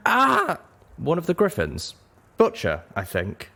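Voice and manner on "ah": sharp high yelp of pain; pained cry. A narrator's reading. Griffin crying out in pain after the crash, a high yelp. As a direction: cry out as the wing breaks. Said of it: "cried out".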